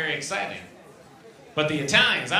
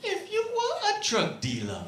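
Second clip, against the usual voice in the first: imitating wife; 10 semitones higher, 5 dB quieter than usual. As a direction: play the wife asking a trap question. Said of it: "she's like".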